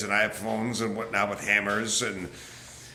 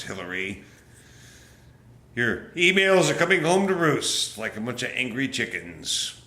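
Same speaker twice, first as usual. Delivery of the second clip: normal talking voice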